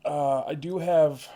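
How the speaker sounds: slow, strained, about to sneeze; fighting off a sneeze mid-sentence; holding back a sneeze. Real, not acted. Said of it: "sorry, I'm trying not to sneeze".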